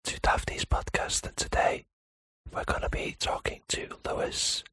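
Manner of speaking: breathy ASMR whisper. Pretend. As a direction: whisper close to the mic. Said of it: "Whisper me your dreams".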